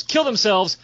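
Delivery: ordinary talk, nothing marked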